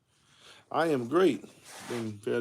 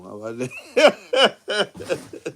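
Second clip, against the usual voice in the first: laughing; 4 semitones higher, 8 dB louder, much slower than usual. Real, not acted. Joking about the commode.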